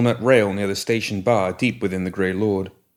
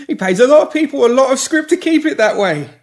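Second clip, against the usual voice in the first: much higher, louder frantic begging; panicked pleading; 8 dB louder than usual. Acted. Pinky pleading with the woman holding shears, panicked. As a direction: beg for your life, backing away.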